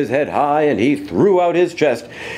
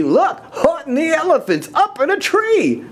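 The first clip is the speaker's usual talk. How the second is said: gleeful shout. Acted. Friends jeer at the elephant up a tree, high and gleeful. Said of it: "they shouted with glee".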